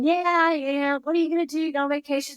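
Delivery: high, chirpy mock back-and-forth about retirement; mimicked small talk. Mimics the usual retirement chat.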